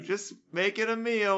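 very high put-on voice; mock innocent excuse. Accused diviner passing it off as dinner, mock innocent. Acting pagan denying fortune-telling.

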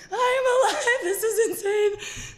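high, loud, laughing disbelief; ecstatic yell. Retold or imitated. Alone after the crash, a high laughing yell. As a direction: laugh out the thrill of surviving.

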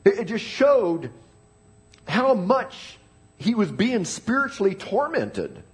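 ordinary talk

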